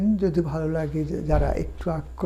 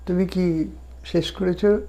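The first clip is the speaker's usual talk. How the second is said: ordinary delivery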